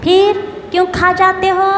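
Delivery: ordinary talk, nothing marked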